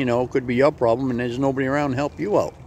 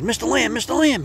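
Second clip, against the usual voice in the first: much higher, squeaky small-mouse voice; a panicked tiny mouse begging for help, pitched way up; frantic little mouse; 11 semitones higher than usual. Acted. Mouse begs the chicken for help, high and squeaky. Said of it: "and he says".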